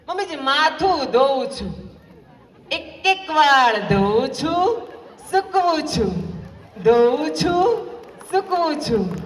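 slow, cheeky drawn-out echo, rising to a shout; mocking reply. Daughter-in-law teasing her mother-in-law, slow and cheeky. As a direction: mimic the old lady's fussiness right back at her.

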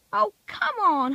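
high, drawn-out groan of disbelief; exasperated. A narrator's reading. Bill realising he was pranked, exasperated. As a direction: stretch it out, high and fed up.

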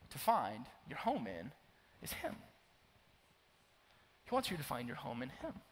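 normal talking voice

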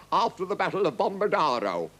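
high, declaimed report; formal captain. A narrator's reading. Captain answering the furious king, declaiming. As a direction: report it boldly to the throne.

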